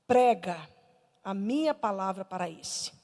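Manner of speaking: lightly voiced word from God; voice of the Holy Spirit. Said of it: "o Espírito Santo falou comigo".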